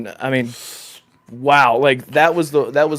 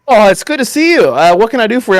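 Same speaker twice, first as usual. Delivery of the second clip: high, loud cheery shopkeeper greeting; chirpy NPC voice; 7 semitones higher, 6 dB louder than usual. Retold or imitated. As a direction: sing it out like an overeager game character. Imitating yawning game NPC.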